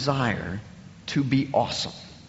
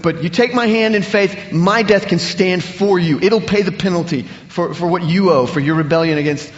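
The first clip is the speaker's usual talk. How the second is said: rising, louder preaching as Jesus' offer; preaching Jesus' words with fervour; impassioned preaching; 3 semitones higher, 5 dB louder than usual. Acted. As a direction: plead the offer with growing force.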